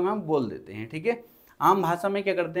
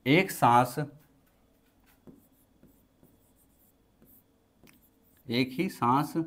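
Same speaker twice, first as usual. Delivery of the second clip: teacher dictating a definition to the class; slow dictation; 4 semitones lower than usual. Acted. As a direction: dictate word by word with long pauses so students can write.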